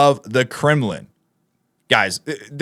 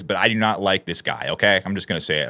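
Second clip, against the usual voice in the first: much lower and softer, half to himself; quiet aside; 8 semitones lower, 5 dB quieter, faster than usual. Real, not acted. Vlogger quietly working up to insulting the EU lawmaker, hushed. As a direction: mutter it low before the outburst. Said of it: "I'm just gonna come right out and say it".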